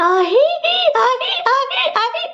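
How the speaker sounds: loud, high ahi cries, pretending to be possessed; mock possession shout. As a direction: try out the trance yell half-jokingly. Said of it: "అరిచేసింటే".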